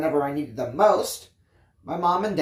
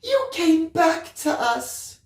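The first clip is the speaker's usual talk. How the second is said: weeping; 12 semitones higher, slower than usual. A narrator's reading. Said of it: "She was also weeping".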